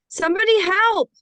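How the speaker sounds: loud, high desperate shout; stranded in the ocean, calling out for rescue; cry for help. Acted. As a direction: scream for help across the water.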